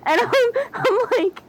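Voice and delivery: high-pitched laughter through the words; can barely speak for laughing. Real, not acted. Friends cracking up over a friend's quote, laughing hard.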